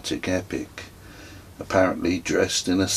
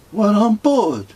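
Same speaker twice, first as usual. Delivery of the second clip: put-on high and louder, stubborn boy; child's voice; 13 semitones higher, 5 dB louder than usual. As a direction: insist like a sulky nine-year-old. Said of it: "said Kez".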